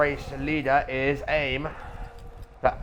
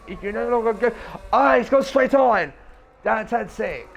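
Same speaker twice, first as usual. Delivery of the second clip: excited; 9 semitones higher, 4 dB louder than usual. Real, not acted. Car goes straight on.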